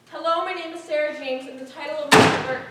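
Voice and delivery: loud and defiant; defiant loud reading. Acted. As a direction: read it out over protest. Reading speech over objections.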